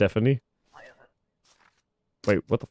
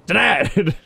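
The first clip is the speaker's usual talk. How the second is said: triumphant shout; 8 semitones higher, 4 dB louder than usual. Pretend. Triumphant announcer denial.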